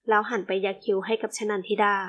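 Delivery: ordinary delivery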